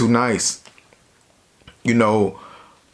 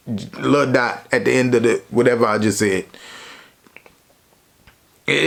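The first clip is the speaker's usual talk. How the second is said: plain reading voice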